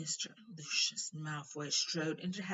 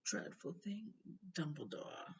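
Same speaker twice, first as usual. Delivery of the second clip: lazy drawl; 7 dB quieter than usual. A narrator's reading. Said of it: "said Malfoy lazily".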